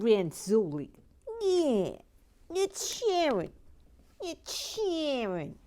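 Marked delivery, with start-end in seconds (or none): 1.4-5.6 high put-on Brooklyn drawl, slow; comic Brooklyn voice. Host greeting viewer Sharon in a mock Brooklyn accent. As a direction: stretch her name in a cartoon New York accent.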